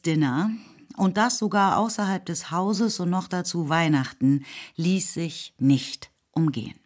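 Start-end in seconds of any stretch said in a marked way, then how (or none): none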